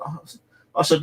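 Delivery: ordinary delivery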